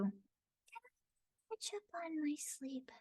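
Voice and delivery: high, airy, breathy sleepy whisper; Sayu's sleepy voice. Shown as a demonstration. Voice actor demoing a drowsy ninja girl, airy and slow. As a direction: sigh it out, half asleep. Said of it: "very airy, flat-mouthed, tiny-mouthed".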